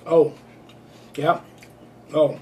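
muffled through chewing; chewing while talking. Real, not acted. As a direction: mouth partly full. Seen 2: Puts bean in mouth at -2; jaw works, lips closed while speaking.

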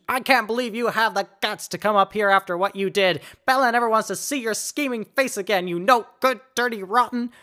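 high, loud shrieking insult; furious scream. Acted. Friend screaming at the deceitful suitor at the gate, furious. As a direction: scream it at him across the gate. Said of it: "she screamed".

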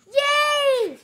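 high, loud yay; happy cheer. Acted. Piggy cheering when Gerald offers to teach her, high and loud. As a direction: burst with joy.

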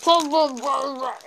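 mouth full. Real, not acted. Mouth stuffed, words gone.

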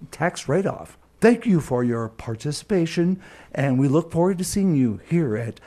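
formal radio promo read; station announcement. Acted.

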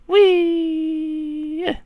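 high, gleeful squeal; gleeful whee. Real, not acted. Player squeals while launching the character, gleeful.

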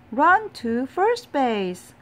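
yelling. A narrator's reading. Boys directing the batter to first base, loud slow yell. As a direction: yell directions to the runner, slowly. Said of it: "yelled the boys".

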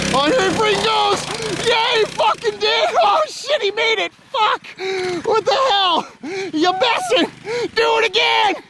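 high-pitched excited outbursts; excited cheering. Real, not acted. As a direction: cheer the rider on, high and thrilled. Cheering on a rider's stunt.

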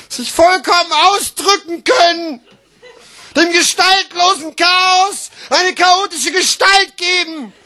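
high, emphatic, exclaimed phrases; grand slam declaiming. Acted. Slam poet exalting self-expression, grandly exclaimed. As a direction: proclaim each phrase like a great triumph.

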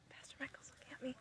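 hushed secret whisper. Real, not acted. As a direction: whisper so the pastor won't hear. Hiding camera from the pastor.

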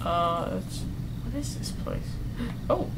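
scared hushed whisper. Real, not acted. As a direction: breathe the words, afraid to be heard. Creeping through dark scary area.

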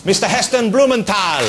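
high, loud announcer shout; big showbiz name reveal; rousing guest introduction. Real, not acted. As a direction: roll out the name for applause. Host announces guest to audience.

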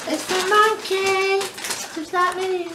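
high slow cooing; high cutesy voice. Real, not acted. Cooing over toy monkey.

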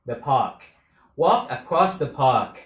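ordinary talk, nothing marked

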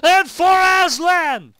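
full-throated screaming war cry; battle scream. Acted. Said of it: "I'm screaming in my house".

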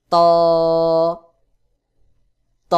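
ত as a heavy, rounded Arabic taa, held long; pressed, hard articulation. Shown as a demonstration. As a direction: press the tongue tip and sound ত hard. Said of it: "চাপ দিয়ে শক্ত করে". (চাপ দিয়ে শক্ত করে ত)

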